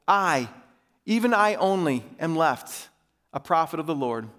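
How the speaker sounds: measured, emphatic public Bible reading; Scripture reading. A narrator's reading. Pastor reading Elijah's showdown to his church, emphatic Scripture reading. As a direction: read it out formally, phrase by phrase.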